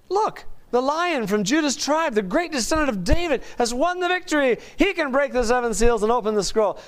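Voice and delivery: high, measured declamation; triumphant scripture proclamation. Acted. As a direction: proclaim the victory like an elder in heaven. Reads elder's triumphant announcement from verse.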